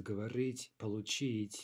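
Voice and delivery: говорить and получить stressed on рить and чить; careful models of говорить, получить with end stress; final-syllable stress. Shown as a demonstration. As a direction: land each word, говорить, получить, on its last syllable. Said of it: "The stress is on the final syllable".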